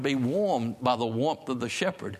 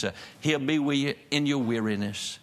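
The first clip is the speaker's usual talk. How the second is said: normal talking voice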